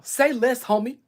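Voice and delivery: put-on loud, high-punched Compton accent; pit-bull Compton accent. Streamer imitating men like pit bulls, Compton accent. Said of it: "Like a pit bull".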